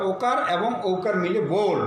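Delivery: normal talking voice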